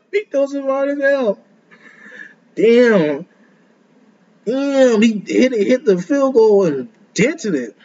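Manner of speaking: high, stunned laugh then gushing; amazed laugh. Real, not acted. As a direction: blown away by the throw. Reacting to the throw.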